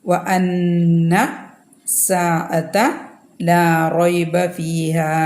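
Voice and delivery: വ അന്ന with the nūn held two counts through the nose, very slow; two-count nasal. Shown as a demonstration. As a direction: stretch the അന്ന hum, then recite on. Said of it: "രണ്ട് count മണിക്കുക". (വ അന്ന എന്നതിലെ നൂൻ രണ്ട് count മണിക്കുക)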